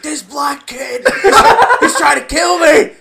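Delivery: high, loud, hammed-up screaming; mock hysteria. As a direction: shriek like an overdramatic caller raising a false alarm. Parodies a hysterical complaining caller.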